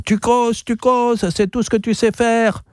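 high, monotone parrot squawk; Laverdure the parrot. A narrator's reading. Parrot cutting into the bar chatter, squawking. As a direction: chant it on one very high pitch like a bird. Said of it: "on entendit la verdure qui poussait son".

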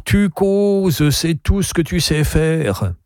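high, put-on parrot voice; squawking parrot. A narrator's reading. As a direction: parrot a jeering refrain. Parrot speaks in the story.

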